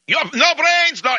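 high-pitched imitated Russian outburst; mock screaming Russian. Russian cutting off attempts to calm him, mock screaming. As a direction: cut in as a shrieking, ranting Russian. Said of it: "and he's screaming and yelling".

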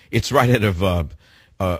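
ordinary talk

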